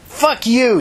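high, loud contemptuous curse; spat out. Acted. Tina cursing the woman who claims the block, spat out high and loud. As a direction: spit the words at her. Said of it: "Tina spat".

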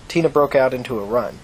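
usual speaking voice

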